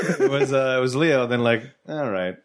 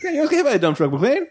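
movie villain quote; 12 semitones higher than usual. Retold or imitated. Quoting Die Hard villain line.

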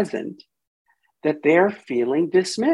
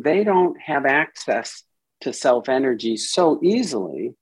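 normal talking voice

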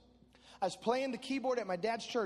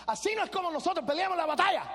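high, rapid-fire Spanish scolding; mad yelling; 8 semitones higher, 5 dB louder, much faster than usual. Retold or imitated. Dad scolds the marching boys in Spanish, mad yelling. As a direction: imitate an angry father's fast Spanish tirade. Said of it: "He starts yelling at us in Spanish".

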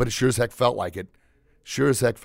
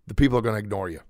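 usual speaking voice

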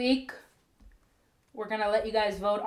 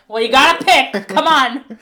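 louder, higher teasing insistence; mock exasperated push; 5 semitones higher, 12 dB louder than usual. Host teasing fans who can't pick, louder and higher. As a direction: playfully badger the fans to choose. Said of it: "And I'm like".

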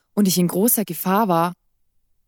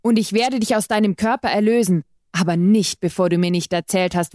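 usual speaking voice

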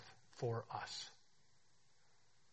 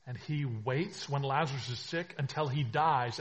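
ordinary talk, nothing marked

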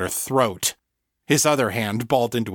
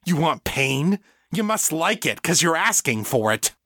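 higher-pitched, raging taunt; enraged; 6 semitones higher than usual. A narrator's reading. Victor attacking the woman he holds, enraged. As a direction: taunt the victim in mad anger. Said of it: "a rage so complete".

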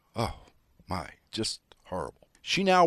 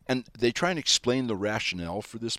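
ordinary delivery

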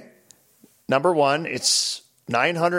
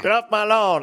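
put-on cranky old man shout; 8 semitones higher than usual. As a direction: bark it like an annoyed old neighbor.

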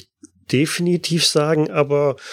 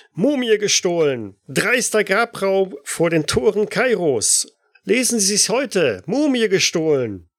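newsboy calling out; 7 semitones higher than usual. Acted. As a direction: shout the headlines like a paperboy. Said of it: "hört ihr einen, äh, Zeitungsjungen rufen auf, ja, gebrochenem Englisch".

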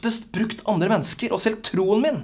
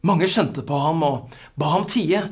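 ordinary talk

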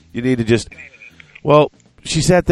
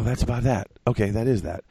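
ordinary talk, nothing marked

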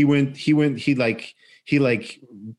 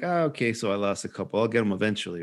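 mild resignation; 4 semitones lower, 6 dB quieter, faster than usual. Retold or imitated. Retold shrug after losing a couple.

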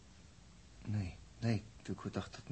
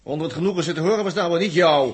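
irritated outburst; 7 semitones higher, 10 dB louder than usual. Acted.

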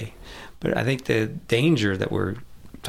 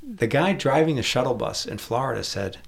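normal talking voice

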